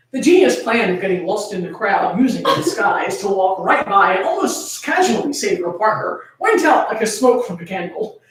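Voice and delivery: ordinary delivery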